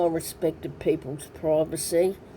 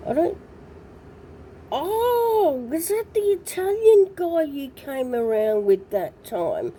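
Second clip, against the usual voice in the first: raised voice, suddenly remembering; high recognising; 10 semitones higher than usual. Retold or imitated. Recalling Minio to Leslie, high and surprised. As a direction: pitch it up as it clicks. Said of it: "And I'm there".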